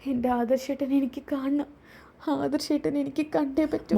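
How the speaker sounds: sick daughter longing for her husband; pleading daughter. Acted. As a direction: plead weakly for him from the hospital bed.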